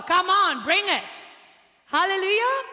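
loud, high call to the crowd; rally the congregation; preacher urging the crowd on, loud; fiery preaching. Acted. Preaching exhortation.

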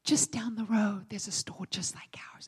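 confidential whisper, imitating the waiter; angelic whisper. Waiter tipping off diners about cheaper store, whisper. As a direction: drop into a hushed, secretive whisper. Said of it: "he was like an angel".

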